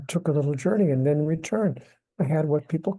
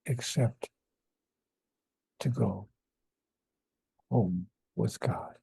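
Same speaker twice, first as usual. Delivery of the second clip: unhurried lowered voice, pausing between phrases; God's voice; 4 semitones lower, 4 dB quieter than usual. Retold or imitated. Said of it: "And God said to me".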